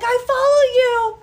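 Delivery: high, loud call from afar; yelling fan. Retold or imitated. Fan yelling from the trail, high and loud. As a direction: shout it up from the trail. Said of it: "yelling from the trail".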